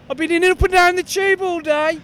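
chirpy Aussie bloke; aussie tradesman. Acted. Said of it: "it's Brian".

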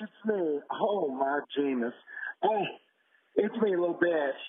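normal talking voice

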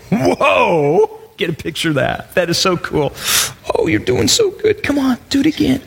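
high, cheering, coaxing parent; hammed-up cheer for a toddler's step; delighted father. Heavenly Father cheering on his toddler, delighted. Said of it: "He is going".

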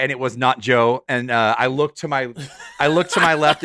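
normal talking voice

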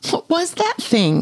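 put-on very high, slow child whine; drawn-out, high-pitched kid's whine; whining kid. A narrator's reading. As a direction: whine it like a scared little kid. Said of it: "he whined".

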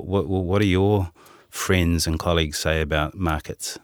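ordinary delivery

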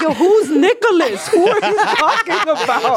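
high puzzled mock question; mock confusion. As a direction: act baffled, laughing. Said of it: "I'm like".

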